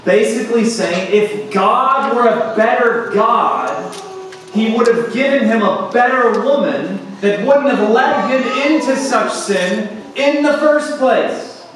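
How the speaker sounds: impassioned pulpit voice; forceful preaching. Acted. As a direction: preach it hard, climbing to the end.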